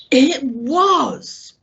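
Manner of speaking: an excited high burst; quite excited. A narrator's reading. Silver hearing Pugh's name, quite excited. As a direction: blurt it out excited. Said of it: "now quite excited".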